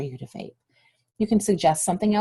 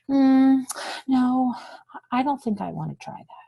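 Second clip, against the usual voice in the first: unconvincing refusal; 4 semitones higher than usual. Shown as a demonstration. Teacher showing students a weak way to refuse, unsure and hesitant. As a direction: say no as if unsure of yourself. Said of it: "sound like I'm uncomfortable or unsure".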